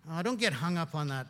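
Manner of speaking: ordinary delivery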